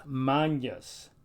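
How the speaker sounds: Magnus as "mahn-yoos". Shown as a demonstration. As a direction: say Magnus with an ny in the middle. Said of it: "more like our N Y".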